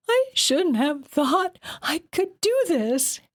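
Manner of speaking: high, slow, sobbing words; shuddering sobs. A narrator's reading. Exhausted girl stuck on the climb, sobbing. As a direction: choke it out through shuddering sobs. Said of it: "through a flurry of shuddering sobs".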